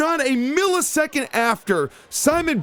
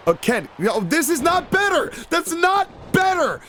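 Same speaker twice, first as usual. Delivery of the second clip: exasperated yell; 5 semitones higher than usual. Real, not acted. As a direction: cry out in dismay. Reacting to wrestler's move.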